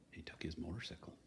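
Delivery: hushed storyteller whisper; suspenseful whisper. Acted. Reading to children, whispering the bandit's turn for suspense.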